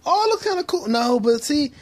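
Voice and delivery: light, high little aside; high cutesy self-admiring voice. Pretend. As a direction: coo at yourself in the mirror, high-voiced. Looks in mirror, high-pitched reaction.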